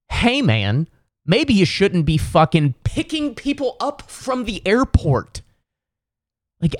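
high, incredulous tirade at the driver; exasperated rant. Retold or imitated. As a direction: pitch it way up in outraged disbelief. Ranting at the Uber driver.

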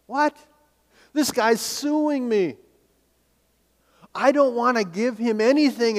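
high, outraged disbelief; indignant surprise. Pretend. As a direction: protest like a wronged listener. Voices the listener objecting.

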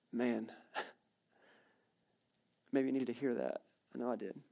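a quiet admission to the room; hushed and sincere; soft personal aside. Real, not acted.

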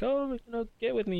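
high, wheedling imitation of a plea; mock begging. Imitating Anakin pleading with Padme, mock begging. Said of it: "begging Padme to".

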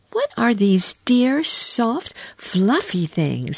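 put-on high, wondering girl voice, easing down at the end; enchanted little girl. A narrator's reading. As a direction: coo over it like a charmed child. Lucy admires the woolly coats.

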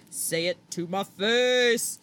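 slow, drawn-out taunting dare; exaggerated, slow call-out; mock challenge. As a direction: stretch each word into a mock threat.

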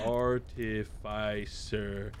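very slow, syllable by syllable; slow enunciation. Shown as a demonstration. Saying it for Google to check, slow careful enunciation. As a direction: sound it out for a machine.

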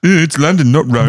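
high-pitched playful put-on voice; mock voice. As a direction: join in the hammy impression. Co-host joins the mock voice.

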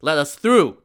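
fast, loud impatient demand; demanding merchants. Acted. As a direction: rush it, push to get through. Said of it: "the merchants demanded".

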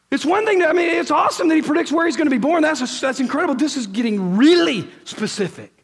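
loud, high, driving sermon voice; preaching at full force. Acted. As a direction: preach it out to the whole hall. Preacher building his case.

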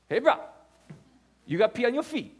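imitating the Hawaiian guys giving matter-of-fact advice; pidgin-tinged island bro voice; Hawaiian local guys. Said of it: "these Hawaiian guys".

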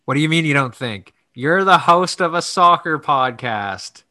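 loud, high, indignant ribbing; mock scolding. Real, not acted. Teasing the host for saying I don't think, loud mock outrage.

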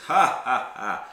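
loud, spaced-out mock laugh; villain's laugh. Game master as the foe answering the challengers, loud villain laugh. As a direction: laugh in separate, booming bursts. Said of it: "He's like, he goes".

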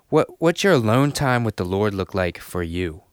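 ordinary talk, nothing marked

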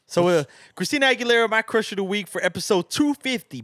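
high, loud hype intro; hype announcement. Real, not acted. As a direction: announce it like a host. Hyped announcement of crush pick.

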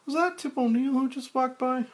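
high, fast woman's voice; Claire. Acted. Claire noticing Frank's change, in a high voice.

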